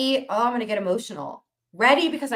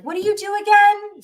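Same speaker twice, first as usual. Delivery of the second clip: high, mocking imitation of an old classmate; lightly lampoon a clueless classmate; mock puzzled question; 11 semitones higher, 4 dB louder than usual. A high school acquaintance asking what she does, mock puzzled.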